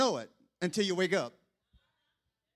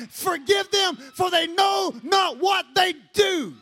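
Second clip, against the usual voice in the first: loud fiery sermon; preaching; 7 semitones higher, 8 dB louder than usual. Acted. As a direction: preach it at full force. Pastor preaching at full force.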